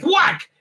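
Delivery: high, punchy mock fight "whack"; cartoon blow effect; shouted whack sound. Acts out the blow.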